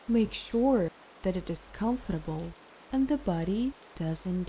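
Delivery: calm meditation guide with long pauses; guided meditation. Acted. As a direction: ease the listener into rest, phrase by phrase.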